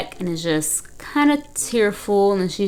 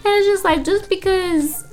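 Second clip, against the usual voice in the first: exasperated; 12 semitones higher than usual. Real, not acted. Exasperated at Tiff.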